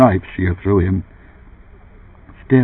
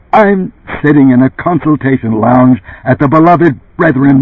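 tense boss explaining; 9 semitones higher, 6 dB louder than usual. Acted. Runsider explains his situation.

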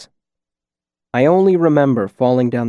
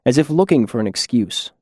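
ordinary delivery

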